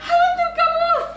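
high, tearful, rattled voice; shaken after nightmare. Acted. Wife telling cousin about the nightmare, high and rattled. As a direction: speak shaken and on edge.

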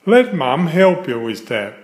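plain reading voice

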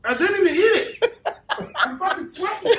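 high pained laughter; laughing through the burn. Real, not acted. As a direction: laugh while your mouth burns. Sweating from hot sauce.

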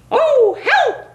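high panicked squawk for help; frightened cry. Acted. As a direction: cry out in alarm. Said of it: "cried the turkey".

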